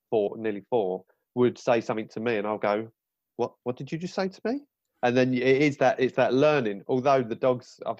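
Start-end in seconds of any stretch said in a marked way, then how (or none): 3.4-4.6 high, quick mock disbelief; affronted parent. Dad to cheeky young son, affronted disbelief. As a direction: imitate a taken-aback parent, rising at the end.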